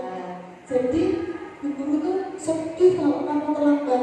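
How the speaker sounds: ordinary delivery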